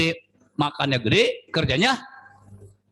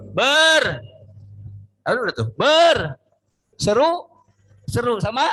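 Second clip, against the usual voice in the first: rising excited cry; 7 semitones higher than usual. Acted. Preacher building to cry out.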